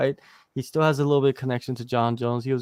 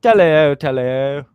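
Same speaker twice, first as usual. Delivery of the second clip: playful posh British greeting, first hello louder; posh English accent; 4 dB louder, slower than usual. Pretend. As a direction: greet like a mock English gentleman. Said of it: "I gotta bring the posh to this podcast".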